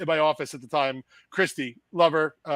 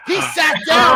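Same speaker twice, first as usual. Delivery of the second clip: loud, high exclamation at the story's climax; outraged shouting; 10 semitones higher, 10 dB louder, slower than usual. Real, not acted. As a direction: shout it in disbelief.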